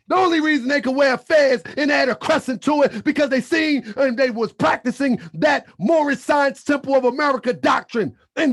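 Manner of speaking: loud, insistent preacher's cadence; emphatic sermon delivery; preaching. Acted. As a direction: drive the doctrine home. Preaching doctrine to the family.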